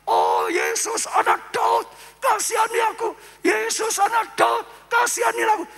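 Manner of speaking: blind beggar's loud, high plea for mercy; calling out. Acted. Blind man calls to Jesus as he passes, loud pleading cry. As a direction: cry out to get a passerby's attention. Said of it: "Dia langsung gak diam".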